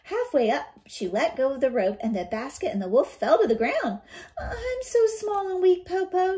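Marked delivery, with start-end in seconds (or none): 4.4-6.4 hammed-up feeble whimper; pretend weakness. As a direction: play helpless to fool the wolf. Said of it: "Shang pretended".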